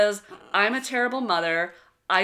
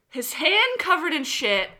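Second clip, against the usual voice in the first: ordinary talk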